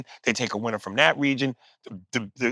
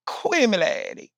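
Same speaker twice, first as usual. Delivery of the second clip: mocking slurred Southern; 9 semitones higher than usual. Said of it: "having an accent is one thing".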